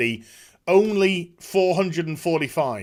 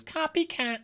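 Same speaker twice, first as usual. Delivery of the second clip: mock taunt; 10 semitones higher, 8 dB quieter than usual. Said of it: "Before any goes".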